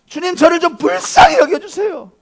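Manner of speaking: high, loud, urgent plea; shouted desperate prayer; Bartimaeus's pleading prayer. Acted. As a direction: beg the Lord at full voice. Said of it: "그렇게 기도했어요".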